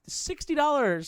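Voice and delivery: high, drawn-out disbelief; incredulous. Retold or imitated. Customer balking at the core fee, incredulous.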